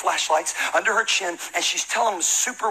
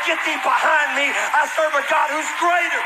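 preaching declaration; 8 semitones higher than usual. Acted. Preaching spiritual warfare aside.

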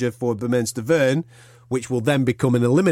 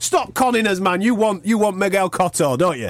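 mock accusing; 8 semitones higher than usual.

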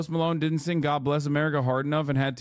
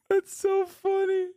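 high, laughing through the words; can't stop laughing at the clip; slower than usual. Real, not acted.

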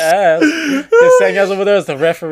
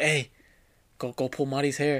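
low, much softer sneaky urging; mock sly whisper-quiet; 5 semitones lower, 13 dB quieter than usual. Brother urging sister to pull Marty's hair, sly quiet voice. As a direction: quietly egg her on. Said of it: "you'd be like".

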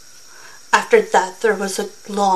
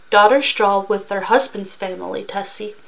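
plain reading voice

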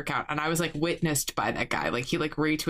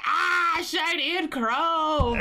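high, drawn-out fanatic yell; war boy cry; 11 semitones higher, much slower than usual. Retold or imitated. Friends playing war boys with silver spray icing, high drawn-out war cry. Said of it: "we're just constantly like".

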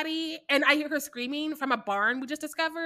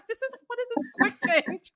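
incredulous mock; 9 semitones higher than usual. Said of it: "I'm like".

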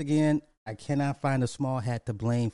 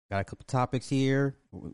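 normal talking voice

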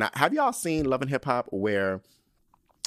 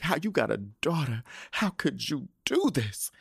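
Erica; 4 semitones higher than usual. Retold or imitated. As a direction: imitate a tearful, appalled friend pleading. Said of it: "She's crying over watching Mimi".

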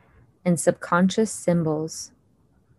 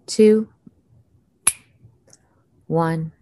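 unhurried calming count with long pauses; slow soothing countdown. Acted. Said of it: "I'm going to count down from ten to one".

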